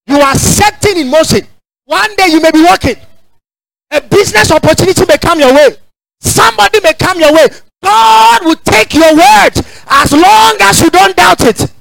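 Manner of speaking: preaching at full force. Acted. Preacher promising the congregation God's answer, rising shout. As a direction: climb and thunder to the crowd.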